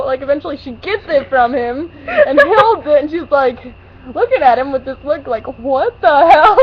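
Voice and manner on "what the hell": loud laughing what the hell; laughing, loud disbelief. Retold or imitated. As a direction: crack up while saying it. Lacey's disbelieving look voiced.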